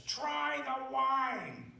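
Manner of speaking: high, slow, sinister sing-song offer; imitating Patrick McGee. McGee's writer offering Alex drugged wine, slow and high. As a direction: draw out the offer slyly, far above your own voice. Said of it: "he says".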